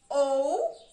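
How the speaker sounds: "ou" held alone, loud, rising at the end; teacher loudly marking the syllable "ou"; syllable demonstration. Shown as a demonstration. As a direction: sound out "ou" as one long, separate syllable. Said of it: "Eu vou marcar a sílaba".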